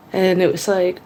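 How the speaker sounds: ordinary delivery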